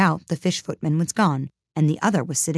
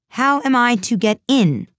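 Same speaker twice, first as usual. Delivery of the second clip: raised, louder and higher demand; repeated aloud; 8 semitones higher, 5 dB louder than usual. A narrator's reading. Alice repeats her question to the footman, aloud and insistent. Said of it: "she repeated aloud".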